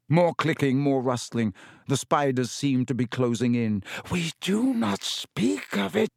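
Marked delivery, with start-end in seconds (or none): 4.1-6.1 high, slow, fierce spider voice. A narrator's reading. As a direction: forbid it fiercely, each word weighted. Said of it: "said Aragog fiercely".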